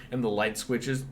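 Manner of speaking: normal talking voice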